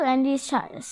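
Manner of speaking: usual speaking voice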